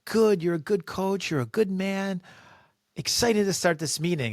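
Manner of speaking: high, cheerful, gushing praise; bubbly compliments. Retold or imitated. Boss praising a colleague in a high bubbly voice. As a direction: beam at the coach. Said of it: "handing out compliments".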